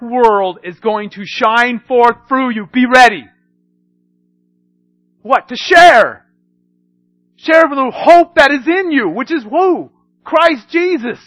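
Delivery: loud, high, driving preacher cry; full-force preaching. Acted. Pastor rallying the congregation, loud high preaching. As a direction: push it out to the whole church.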